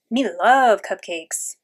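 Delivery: slow, drawn-out monster craving; playful slow monster voice; Cookie Monster. A narrator's reading. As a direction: stretch it out like a greedy puppet monster. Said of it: "That's Cookie Monster who talks like that".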